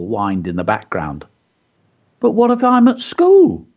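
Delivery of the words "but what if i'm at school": put-on child voice. A narrator's reading. Child's worried question.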